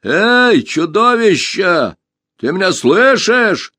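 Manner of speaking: high, loud call across the woods; shout. A narrator's reading. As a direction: call out to the monster. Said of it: "крикнул".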